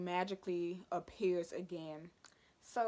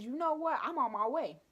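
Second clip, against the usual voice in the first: fed-up mom; 6 semitones higher, 5 dB louder than usual. Retold or imitated. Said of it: "So my mom says".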